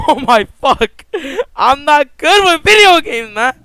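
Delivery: loud, high panic; panicked yelling. Real, not acted. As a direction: shout it in frantic fear. Panicked after jump scare.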